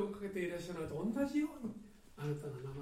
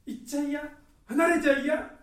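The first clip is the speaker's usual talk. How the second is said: put-on higher, louder childlike pleading; clinging plea; 10 semitones higher, 13 dB louder than usual. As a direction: beg him not to go, like a child. Mary clinging to Jesus. (子供のように高く必死にすがる)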